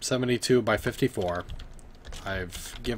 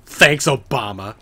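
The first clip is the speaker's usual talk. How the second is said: sarcastic; 2 semitones higher, 11 dB louder than usual. Pretend. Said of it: "have you, Obama".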